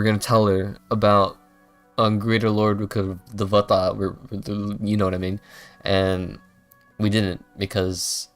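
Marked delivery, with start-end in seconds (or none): none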